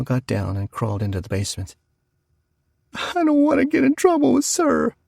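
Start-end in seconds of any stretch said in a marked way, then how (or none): 2.9-4.9 put-on high wailing child's cry; a little girl bawling in fear. A narrator's reading. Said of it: "bawled Connie".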